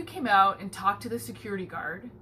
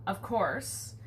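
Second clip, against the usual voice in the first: usual speaking voice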